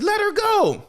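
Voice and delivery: high-pitched yelled order; mock frantic demand. Demanding they free the girl, high mock-frantic cry.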